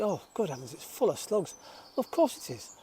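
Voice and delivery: ordinary talk, nothing marked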